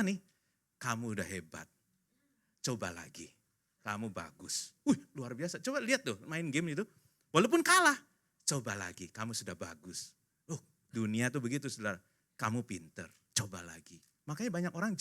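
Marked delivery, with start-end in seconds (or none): 0.8-4.7 soft, low consoling game voice; soothing encouragement. Acted. Game consoling a child who lost, soothing. Game's consoling voice after losing. 8.4-10.1 soft, low consoling game voice; soothing encouragement. Acted. Game consoling a child who lost, soothing. 12.3-14.0 soft, low consoling game voice; soothing encouragement. Acted. Game consoling a child who lost, soothing.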